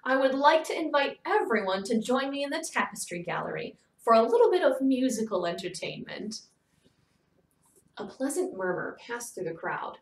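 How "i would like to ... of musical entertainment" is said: bright and cheerful, a lift in the voice; a gracious hostess sounding delighted; happy hostess announcing to guests; said happily. Acted. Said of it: "she said happily".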